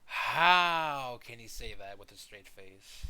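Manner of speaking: slow, loud, disbelieving; how could anyone say that; incredulous. Real, not acted. Reacting to the clip's claim.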